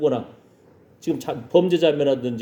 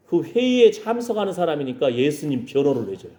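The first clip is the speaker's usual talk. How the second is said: ordinary talk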